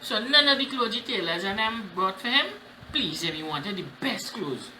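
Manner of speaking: usual speaking voice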